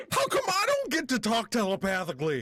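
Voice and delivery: left-out complaint. Acted. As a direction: protest being left out of the secret. Character complains of being left out.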